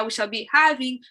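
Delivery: ordinary talk, nothing marked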